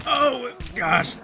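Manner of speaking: high, loud exclamation; alarmed cry. Real, not acted. Player being chased, alarmed cry.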